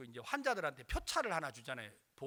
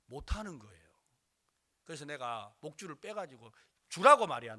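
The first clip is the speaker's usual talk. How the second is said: usual speaking voice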